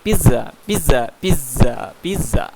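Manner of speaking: loud, punchy Italian-accented pizza. Shown as a demonstration. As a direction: hit each pizza loudly in an Italian accent. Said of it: "logatnya logat Itali".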